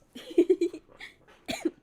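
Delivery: loud burst of laughter; embarrassed loud laugh. Real, not acted. Laughing at own bad accent.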